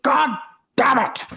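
high-pitched mock cursing, split by a pause; imitated angry outburst. Actor retelling a co-star's fury at fast learning, exasperated outburst. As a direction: curse like an exasperated co-star. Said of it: "he got so mad".

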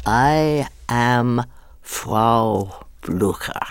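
stretched-out, measured words; slow ominous delivery. Shown as a demonstration. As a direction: take forever over every word. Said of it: "But I said".

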